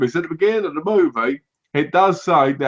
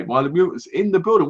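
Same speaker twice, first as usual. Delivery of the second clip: usual speaking voice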